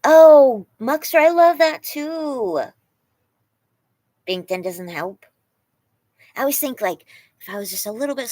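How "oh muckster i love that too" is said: loud, high, drawn-out delight; loud slow gushing praise. Real, not acted. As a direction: swoon over the comment out loud. Reacting to chat comment.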